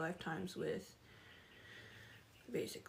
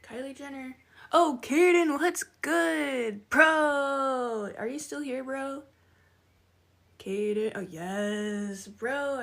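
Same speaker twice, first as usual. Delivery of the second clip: higher, louder, delighted shout-out to a friend; excited greeting; 5 semitones higher, 10 dB louder, much slower than usual. Real, not acted. Streamer happily calls out returning viewer Kaden, loud and excited. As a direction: call out their name like a long-lost buddy.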